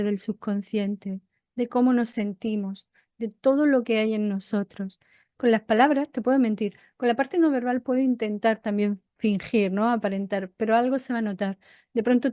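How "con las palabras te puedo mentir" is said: usual speaking voice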